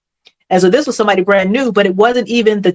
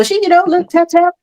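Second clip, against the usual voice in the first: light tapping; 10 semitones higher than usual. Retold or imitated. Retelling tapping on the ceiling.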